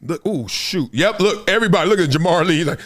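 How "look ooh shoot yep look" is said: usual speaking voice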